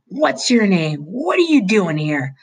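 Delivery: hollering. A narrator's reading. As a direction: holler at the kid in the junkyard. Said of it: "the foreman hollered".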